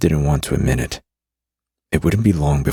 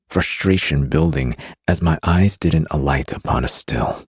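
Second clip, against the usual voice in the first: usual speaking voice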